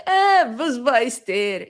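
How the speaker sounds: mock-dismissive Yiddish grumble. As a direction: brush it off like an old yeshiva man. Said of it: "I'll embody a certain dismissive".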